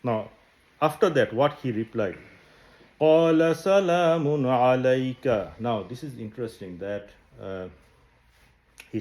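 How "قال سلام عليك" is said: Quran recitation. Acted. Quran verse recited in Arabic.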